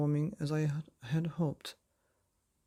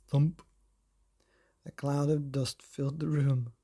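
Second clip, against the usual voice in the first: plain reading voice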